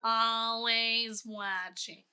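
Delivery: very slow, creepy slug lady drawl; Roz from Monsters, Inc. Acted. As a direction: drag it out, sinister and deadpan. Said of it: "imitating the slug lady from Monsters, Inc".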